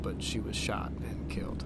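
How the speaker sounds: whispered, soft and unvoiced; whispering the painful part of the story; hushed grief. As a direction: let the words fall to a whisper of grief. Recounting friend's death.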